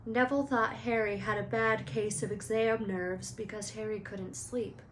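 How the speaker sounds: plain reading voice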